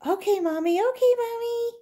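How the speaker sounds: very high, excited squeal; excited girls. Acted. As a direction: squeal with joy.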